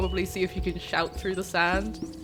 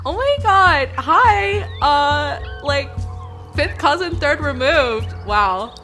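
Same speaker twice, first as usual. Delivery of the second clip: excited gushing; 3 semitones higher, 4 dB louder than usual. Acted. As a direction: win the snake over excitedly. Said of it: "and be like".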